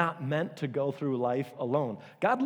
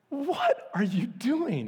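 high, slow, drawn-out what are you doing; incredulous question; 9 semitones higher, much slower than usual. Retold or imitated. Asking a spaced-out friend, high and slow. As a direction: stretch it out in disbelief.

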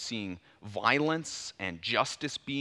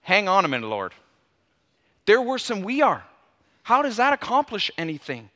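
loud and higher, arguing back in disbelief; indignant protest; 5 semitones higher, 7 dB louder than usual. Retold or imitated. Prophet protesting God's plan, loud, higher and indignant. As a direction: object hard, as if God can't mean it. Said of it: "You can almost hear that right in the text".

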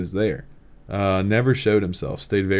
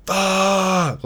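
sudden high yelled curse; a retold scream of frustration; 10 semitones higher than usual. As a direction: blurt it out like a frustrated yell in the woods. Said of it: "just screamed in the woods".

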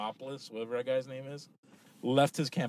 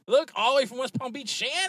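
higher, louder excited introduction; excited announcing; 12 semitones higher, 6 dB louder than usual. Real, not acted. To listeners, introducing Shannon arriving: higher, louder excited call. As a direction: show her off to the listeners with glee.

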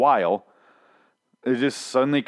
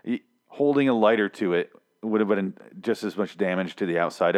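usual speaking voice

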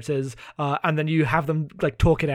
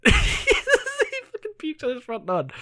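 laughing disbelief; 11 semitones higher, slower than usual. Real, not acted. Laughing at the pathetic character.

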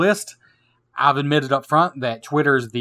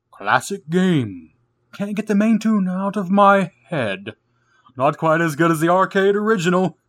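spooky voice; 4 semitones higher than usual. Pretend. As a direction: read it like a haunted house host. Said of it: "I'll read this comment from Twitter in a spooky voice".

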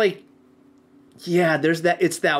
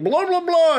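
higher mock gibberish shout; imitated garbled yelling; 11 semitones higher, 3 dB louder than usual. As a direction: mimic someone yelling something you can't understand. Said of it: "It just sounds like".

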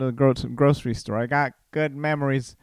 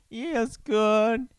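silly high, drawn-out repeat; 10 semitones higher, much slower than usual. Pretend. Host clowning on his own remark, silly high voice. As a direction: play it up high and slow.

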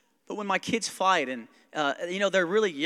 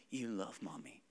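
put-on much softer, tender child's plea; soft, near tears; 7 semitones lower, 14 dB quieter than usual. Daughter pleading with her father, soft and tearful. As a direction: hush it like a little girl near tears. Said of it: "really soft, almost be in tears".

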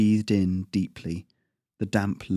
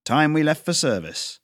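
raised, higher call from the house; father calling out; 7 semitones higher than usual. Acted. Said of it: "his father's shout rang out".

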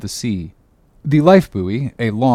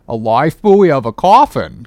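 a louder startled exclamation, falling off at the end; startled cry; 2 semitones higher, 5 dB louder than usual. A narrator's reading. Starbuck startled by the coffin idea, crying out. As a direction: blurt it out in shock. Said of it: "cried Starbuck, starting".

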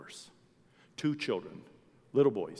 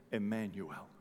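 ordinary talk

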